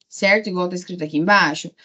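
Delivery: normal talking voice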